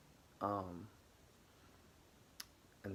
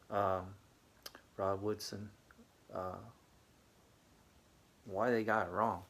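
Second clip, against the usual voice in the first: ordinary talk, nothing marked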